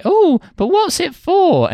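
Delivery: put-on very high, slow falsetto innocent girl; mock-innocent Cinderella squeak; naive Cinderella. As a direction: play the clueless princess in falsetto. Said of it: "then she's like".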